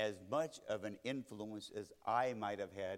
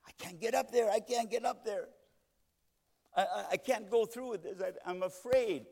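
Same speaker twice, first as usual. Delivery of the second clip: higher and louder, stammering with stage fright; a nervous man begging not to be sent up; panicky truck driver; 8 semitones higher, 5 dB louder than usual. Retold or imitated. Preacher voices a truck driver's panic before speaking, afraid and stammering. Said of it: "the truck driver sitting next to Jimmy Carter said".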